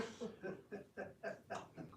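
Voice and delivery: breathy conspiratorial chuckle; sly snicker. Acted. Ally sharing the joke, quiet snicker. Ally laughs along with plot.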